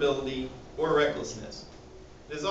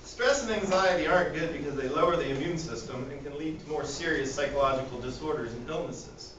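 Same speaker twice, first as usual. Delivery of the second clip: normal talking voice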